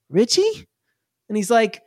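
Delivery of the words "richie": put-on high, questioning kid voice; surprised sibling. Kid in top bunk spots his brother, high surprised question. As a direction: pipe up in disbelief, pitched high. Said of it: "is like".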